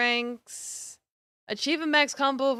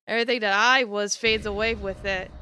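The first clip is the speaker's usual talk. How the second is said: usual speaking voice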